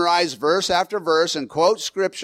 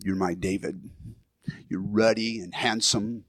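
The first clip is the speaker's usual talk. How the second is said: quietly quoting wife; 8 semitones lower, 8 dB quieter than usual. Retold or imitated. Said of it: "Or, like".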